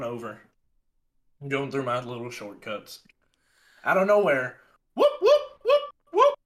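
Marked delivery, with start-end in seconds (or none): 4.9-6.3 siren whoop. Retold or imitated. Imitating police siren.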